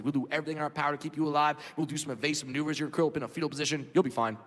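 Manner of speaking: low, rapid military briefing voice; pilot briefing. Retold or imitated. Pilot briefing passengers before the flight, brisk and low. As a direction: rattle off the safety speech like a bored pilot. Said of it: "Pilot looks at us and goes".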